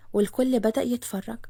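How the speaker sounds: ordinary delivery, nothing marked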